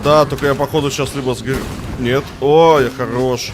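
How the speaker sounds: loud excited shouting mid-fight; raging gamer. Real, not acted. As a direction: yell it in the heat of battle. (громкий азартный крик)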